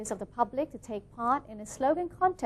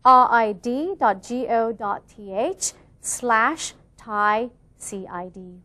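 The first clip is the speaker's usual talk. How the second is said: slowly, letter by letter; slow, spelled out; 6 dB louder, slower than usual. Shown as a demonstration. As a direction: dictate each letter slowly. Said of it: "Once again".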